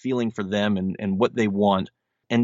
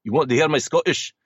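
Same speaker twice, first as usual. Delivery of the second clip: higher, put-on Scottish brogue; Scottish accent; 5 semitones higher, faster than usual. Shown as a demonstration. Actor shows interviewer his stage accent on request, Scottish brogue. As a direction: play it as a jokey Scottish impression. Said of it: "we wanna hear the Scottish accent".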